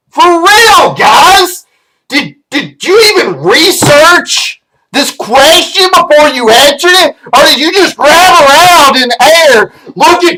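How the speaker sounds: high-pitched, indignant telling-off; worked-up, incredulous outrage; exasperated rant. Acted. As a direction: scold them for not doing their homework. Rants at podcast hosts' poor research.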